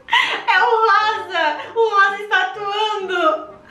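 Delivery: high, slow laughing protest; laughing accusation. Real, not acted. Accusing the pink player through laughs, high and slow.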